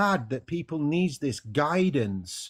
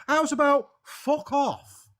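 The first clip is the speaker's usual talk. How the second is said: defiant reply; 9 semitones higher, slower than usual. Pretend. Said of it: "reply to God".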